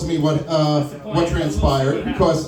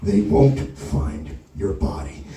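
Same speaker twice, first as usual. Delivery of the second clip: menacing threat; 10 semitones lower than usual. Retold or imitated. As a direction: growl it close into his ear. Pull him in real close.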